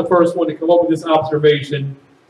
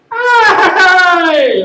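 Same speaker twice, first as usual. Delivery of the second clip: high, loud Italian cry; hammed-up Italian exclamation; exclaiming; 12 semitones higher, 4 dB louder than usual. Shown as a demonstration. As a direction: call it out high and long. Demonstrating the letter I as exclamation.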